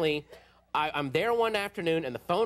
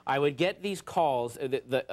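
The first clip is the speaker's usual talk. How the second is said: ordinary delivery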